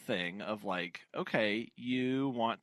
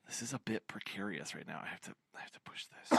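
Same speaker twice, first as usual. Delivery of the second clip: fast soft whisper; 3 semitones lower, 6 dB quieter, much faster than usual. Real, not acted. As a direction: whisper quickly while concentrating. Whispered while handling gear.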